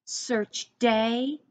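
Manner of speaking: usual speaking voice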